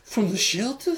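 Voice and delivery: high incredulous question; lightly voiced stunned movie character; mock surprise. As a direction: ham up the shocked rise.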